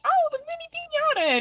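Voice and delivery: very high, squealed oh of discovery; a high-pitched replay of her own surprise; mock sudden realization. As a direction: act out suddenly spotting the mini piñata. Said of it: "I'm like".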